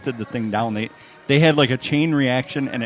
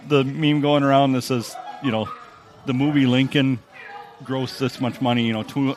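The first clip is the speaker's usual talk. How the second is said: normal talking voice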